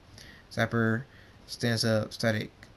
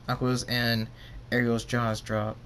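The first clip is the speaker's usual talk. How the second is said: plain reading voice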